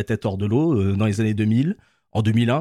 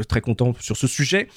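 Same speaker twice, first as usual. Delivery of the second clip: ordinary talk, nothing marked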